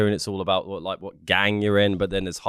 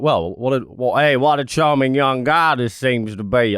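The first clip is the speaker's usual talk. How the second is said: Southern American; 2 semitones higher than usual. Retold or imitated. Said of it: "the Anglophiles over there will be like".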